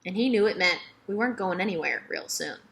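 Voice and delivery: usual speaking voice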